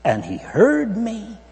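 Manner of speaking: loud, high, ringing preacher's cry; preaching at full force. Acted. Preacher to the congregation, quoting David in a full-force cry. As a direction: lift David's words to the rafters, slow at the end.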